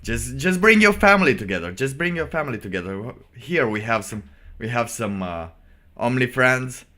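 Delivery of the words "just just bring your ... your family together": mock-cheerful, higher and louder, sneering at the video; sarcastic. Streamer mocking the Grammy video, sarcastic cheer. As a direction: sell it like a fake wholesome ad.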